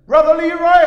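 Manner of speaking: loud, high American preacher calling out; American accent. Retold or imitated. Preacher calling his pastor by name, American accent. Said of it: "not a bad American accent".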